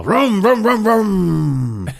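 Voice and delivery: high revving engine noise, falling away; chainsaw imitation. Comic telling a film's chainsaw scene, imitated engine revs.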